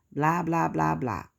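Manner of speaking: ordinary talk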